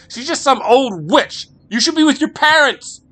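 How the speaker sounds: angry. Acted. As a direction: hurl it at him. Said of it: "spat Marie".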